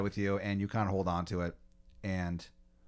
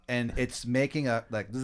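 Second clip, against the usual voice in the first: ordinary talk, nothing marked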